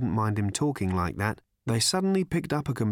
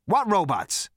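sharp, higher-pitched demand; sharply; 9 semitones higher, 4 dB louder than usual. A narrator's reading. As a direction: fire the question back sharply. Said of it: "said Zaphod sharply".

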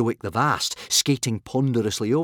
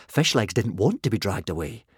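usual speaking voice

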